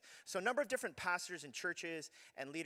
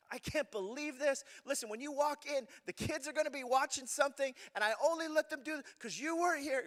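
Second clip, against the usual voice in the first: high, loud exasperated rant; angry message; 11 semitones higher, 6 dB louder than usual. Retold or imitated. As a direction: let the disbelief spill out, higher and louder. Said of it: "to leave, like, one last message".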